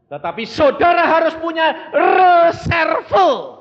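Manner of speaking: loud, high preaching cry; full-force preaching. Acted. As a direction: thunder it to the congregation.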